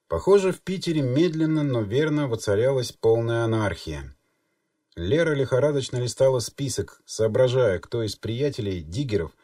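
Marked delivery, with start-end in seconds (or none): none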